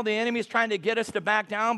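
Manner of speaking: ordinary talk, nothing marked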